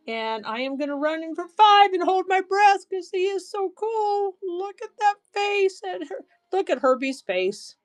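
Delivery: gushing excitement. Real, not acted. Seller gushing to viewers over a bug brooch, climbing high with delight.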